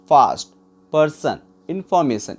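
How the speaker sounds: ordinary delivery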